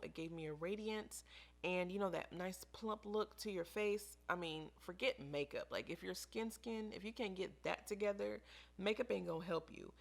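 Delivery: ordinary delivery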